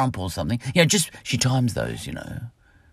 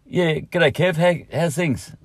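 loud, high go at a casual Australian greeting; higher, louder, half-successful everyday Australian accent; attempted real-life accent; 8 semitones higher, 4 dB louder, slower than usual. Shown as a demonstration. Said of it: "See, I just tried then".

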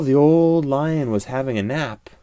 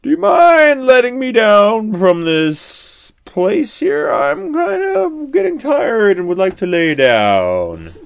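higher, louder tired lion asking; old lion voice; 12 semitones higher, 11 dB louder than usual. A narrator's reading. Old lion asks the monkey to let him down, higher and louder. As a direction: ask wearily like a stuck old lion. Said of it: "and he said".

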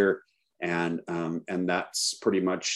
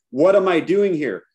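acted-out panic, higher and louder; freaking out; 9 semitones higher, 5 dB louder than usual. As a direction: demand answers in mock desperation. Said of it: "I'm freaking out".

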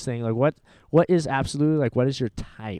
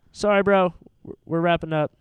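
raised, higher-pitched apology; calling out; 5 semitones higher, 5 dB louder than usual. Real, not acted. Host apologizing to a man who interrupted, calling out. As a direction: call over to someone outside, apologetic.

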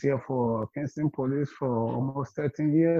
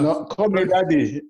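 insistent loud; 7 semitones higher, 7 dB louder than usual. Real, not acted. Father insisting, louder.

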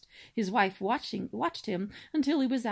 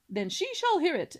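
put-on loud, higher, grandiloquent old swan; pompous cob swan; 8 semitones higher, 5 dB louder than usual. Father swan listing his son's virtues, grandly booming. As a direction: declaim like a self-important father swan.

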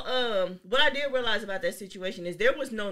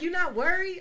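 worried callers; 10 semitones higher, 4 dB louder than usual. Retold or imitated. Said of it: "They're like".

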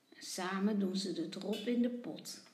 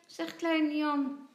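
ordinary delivery

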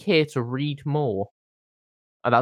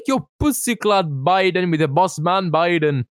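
slow, higher, a mock Jamaican patter; 4 semitones higher, 3 dB louder than usual. Mocking a celebrity to his friend with a fake Jamaican accent. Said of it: "doing a Jamaican accent at all times".

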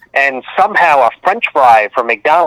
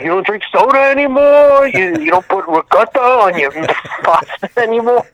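imitated Italian dad; 8 semitones higher than usual. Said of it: "my dad was like".

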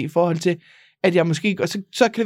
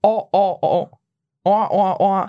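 år said five times in broad North Jutland; much slower than usual. Shown as a demonstration. Asked by her friend, North Jutland år five times. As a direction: repeat år in your home dialect. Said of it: "Prøv at sige det".

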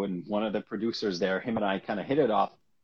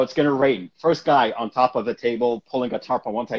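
normal talking voice

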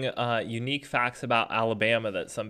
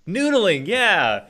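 surprised recognition; 7 semitones higher, 5 dB louder, much slower than usual. Real, not acted. Hears the word, recognizes it.

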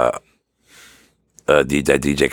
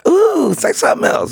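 very high, cooing mock-female voice; exaggerated falsetto fangirl; smitten woman; 4 dB louder than usual. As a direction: squeal it like a swooning admirer. Voicing the woman hearing French.

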